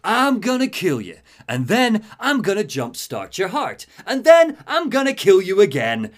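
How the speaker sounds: loud, high villain threats; Electrocutioner. Acted. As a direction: bellow it like a cartoon bruiser. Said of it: "he appears and he's like".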